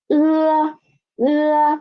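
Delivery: e said high and drawn out; teacher modelling e; pinyin e demo. Shown as a demonstration. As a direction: model the vowel e clearly. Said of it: "e is actually e".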